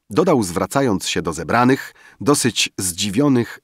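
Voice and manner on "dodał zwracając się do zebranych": usual speaking voice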